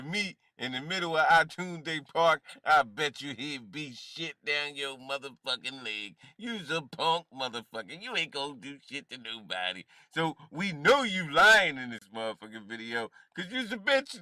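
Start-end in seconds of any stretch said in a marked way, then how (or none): none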